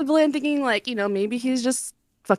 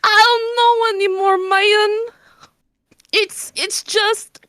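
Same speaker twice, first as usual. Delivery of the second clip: half-laughing sob; 6 semitones higher, slower than usual. Real, not acted. Laugh-crying over first kiss.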